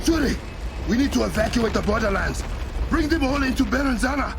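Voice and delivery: high, loud urgent orders; king ordering the evacuation; urgent calling out. Acted. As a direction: shout to get everyone moving.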